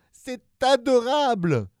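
very high, loud, slow wail; horrified. Acted. Terrified monster wailing about the cute baby. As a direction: wail it like it's a horror.

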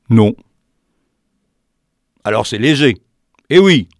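plain reading voice

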